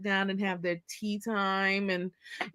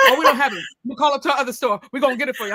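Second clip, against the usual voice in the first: loud, higher sales patter; hustling store clerk; 3 semitones higher, 8 dB louder, much faster than usual. Retold or imitated. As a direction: imitate a pushy clerk taking charge. Acts out fast-paced city clerk.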